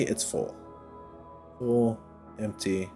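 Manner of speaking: ordinary talk, nothing marked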